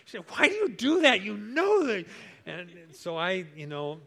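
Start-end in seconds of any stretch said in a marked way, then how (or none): 0.3-2.0 high, loud mock scolding wife; angry scolding. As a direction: scold him like an upset wife. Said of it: "She's so mad".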